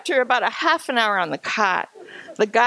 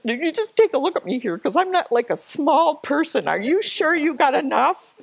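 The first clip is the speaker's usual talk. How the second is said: high-pitched mock worry, rising higher; retold incredulous challenge; 7 semitones higher than usual. Hiker to head guide about helpers, imitated disbelief. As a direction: question it insistently in a raised voice.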